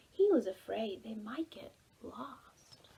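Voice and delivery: slow, unvoiced whisper; hushed narration. A narrator's reading. Storyteller to children, slow whisper. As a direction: whisper it like a secret.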